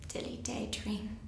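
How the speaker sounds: slow, whispered aside; hushed musing. Acted. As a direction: whisper it slowly, almost to yourself. Musing on Maureen believing fairy stories.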